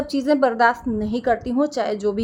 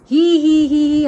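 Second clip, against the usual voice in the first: loud, derisive "hee hee" imitation; put-on mocking laugh; mock laughter; 5 dB louder than usual. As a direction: mimic their giggling with exaggerated, spoken hees. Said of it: "हंसने लगे".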